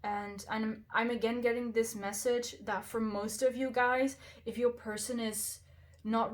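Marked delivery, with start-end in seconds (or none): none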